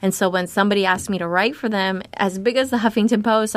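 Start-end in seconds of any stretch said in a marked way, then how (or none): none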